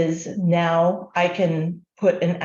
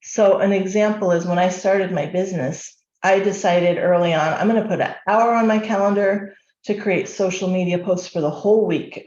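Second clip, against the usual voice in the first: usual speaking voice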